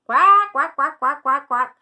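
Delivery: rapid-fire loud quacks; shouting duck. A narrator's reading. Dottie sitting with Quincy in the park, shouted quacking. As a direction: quack at full volume. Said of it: "shouts Dottie".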